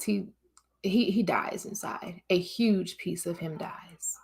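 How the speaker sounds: hushed, unvoiced whisper of grief. Real, not acted. Telling the men how a man breaks inside, whispered. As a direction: whisper it like a heavy secret. Grief over men's despair.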